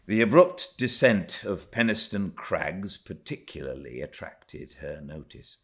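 plain reading voice

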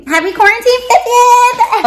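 excited. Real, not acted. Excited birthday wish, high cry.

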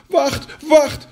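high, loud cry after someone running off; high-pitched pleading shout; frog calling out. Acted. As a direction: call out urgently in a high frog voice. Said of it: "riep de kikker".

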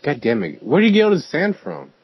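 ordinary talk

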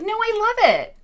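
loud, high-pitched fake enthusiasm; mock insistence. Imitating someone with crossed arms, falsely enthusiastic. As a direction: protest shrilly that all is fine, hammed-up. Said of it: "like, crossing their arms".

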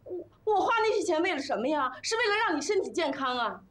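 very high, loud wailing protest; aggrieved outcry. Acted. Wife defends her spending to her ungrateful husband, aggrieved outcry. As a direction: cry out in hurt indignation, pitch soaring.

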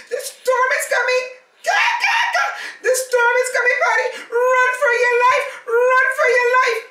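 very high, loud, fast frantic screech; panicked warning. Acted. Fleeing gull shrieks to Paddy, frantic and loud. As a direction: scream the warning in terror.

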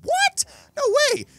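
very high, squealed what; shocked disbelief. Real, not acted. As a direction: gasp at the cannon. Shocked at a plasma cannon.

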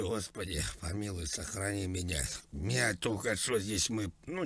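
normal talking voice

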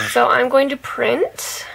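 ordinary talk

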